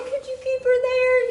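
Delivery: high pleading voice, pitch jumped way up; high-pitched plea to keep mom out; begging. Retold or imitated. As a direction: beg the staff in a high voice. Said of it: "I was like".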